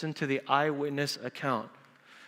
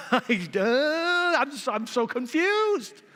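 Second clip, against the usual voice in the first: higher, slow, baffled stammer; confused husband; 8 semitones higher, slower than usual. Retold or imitated. Husband baffled by his wife, a hint of higher stammer. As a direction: stumble over it, lost. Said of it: "the husband's like".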